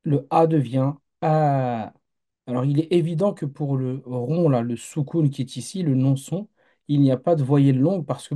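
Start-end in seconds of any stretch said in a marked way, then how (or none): 0.0-1.9 "A" short first, then the second "A" held long, slow; slow contrast of short and long "A"; short then long vowel. Shown as a demonstration. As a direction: stretch the second "A" to two beats. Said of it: "j'ajoute cette lettre-là".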